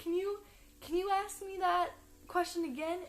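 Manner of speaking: wife composing herself to ask, lightly imitated; imitating amused wife. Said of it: "she was laughing so hard".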